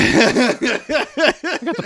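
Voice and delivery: high, drawn-out words through laughter; laughing. Real, not acted. Speaker owning up to a botched name, laughing. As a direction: laugh helplessly while defending the slip.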